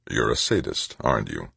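low, level accusation; hard, quiet menace, well below normal pitch; low, flat, hard. A narrator's reading. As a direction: drop the voice and accuse coldly. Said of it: "his voice low, flat, hard".

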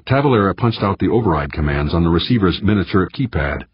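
usual speaking voice

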